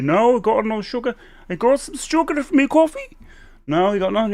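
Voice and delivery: high imitated back-and-forth; acted-out advert exchange. Retelling a sugar advert argument, high acted voices. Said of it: "And he's like".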